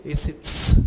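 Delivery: ts said as a voiceless t-s hiss; ts sound demo. Shown as a demonstration. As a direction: hiss the ts sharply. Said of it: "ts é o som do tsad". (ts sem voz)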